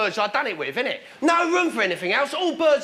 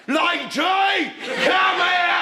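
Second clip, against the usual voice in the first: high, drawn-out yell; calling the dog; 6 semitones higher, much slower than usual. Acted. Owner calls his dog Lady, hammed-up drawn-out shout. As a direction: bellow for a dog, stretching each word. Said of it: "I call her".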